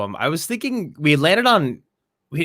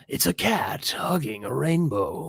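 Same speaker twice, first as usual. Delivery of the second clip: trailer voice; 6 dB quieter, slower than usual. Pretend. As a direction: announce it like an epic film trailer. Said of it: "Narrate them in the trailer voice".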